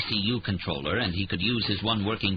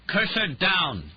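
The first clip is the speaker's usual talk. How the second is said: higher, slow, louder command; called louder; 10 semitones higher, 3 dB louder, much slower than usual. A narrator's reading. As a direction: call it out louder for the machine. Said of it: "he called louder".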